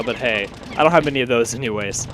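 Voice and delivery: ordinary talk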